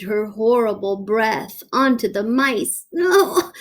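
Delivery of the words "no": high squeaky mouse panic; frightened soldiers crying out in alarm; panicked scream. A narrator's reading. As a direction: shriek like terrified little mice. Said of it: "screamed the soldiers".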